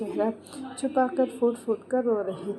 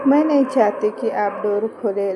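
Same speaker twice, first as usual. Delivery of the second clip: louder, emphatic refusal; insistent plea; 8 dB louder than usual. A narrator's reading. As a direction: plead forcefully, much louder. Plea repeated with much more force. (ज़ोर देकर, ऊँची आवाज़ में विनती)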